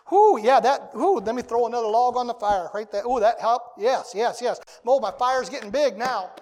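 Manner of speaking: loud, delighted ooh and yes; preacher playing a man excited over Scripture; thrilled reader. Imagined reader thrilled by the word.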